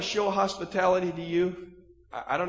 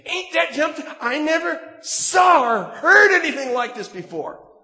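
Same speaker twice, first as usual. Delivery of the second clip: put-on loud, higher Jersey accent, laughing; Jersey twang; 10 semitones higher, 9 dB louder than usual. Inmate marveling to the preacher in a Jersey twang. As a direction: do the amazed guy in a Jersey accent. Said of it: "in his new Joyzy twang".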